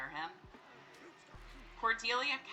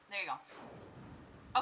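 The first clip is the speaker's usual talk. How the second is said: soft, slow whisper; whispered reassurance; 9 dB quieter, much slower than usual. Real, not acted. Gamer soothing the child Sim, soft whisper. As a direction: soothe the little one gently.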